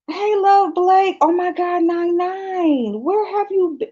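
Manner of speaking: high, stretched-out squeal of welcome; overjoyed greeting. Real, not acted. Streamer greeting long-missed friends, overjoyed. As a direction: greet them high, overcome with delight.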